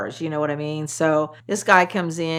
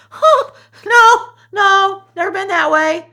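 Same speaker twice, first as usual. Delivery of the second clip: mock nervous; 11 dB louder than usual. Scared reply retold.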